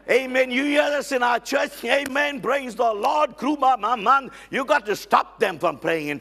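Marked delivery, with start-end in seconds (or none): none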